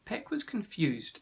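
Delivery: plain reading voice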